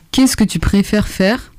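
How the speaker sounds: normal talking voice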